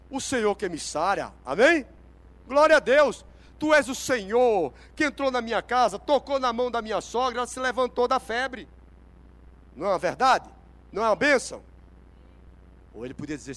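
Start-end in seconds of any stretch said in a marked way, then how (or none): 0.1-8.7 loud, raised proclamation; fervent preaching praise. Acted. Peter praising the Lord who healed his household, fervent proclamation. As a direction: proclaim it with full-force gratitude.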